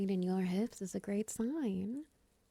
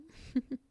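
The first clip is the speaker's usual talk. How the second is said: high, short giggle; little giggle; 8 semitones higher than usual. Acted. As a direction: giggle lightly at your own remark. Joking about silk as bandage.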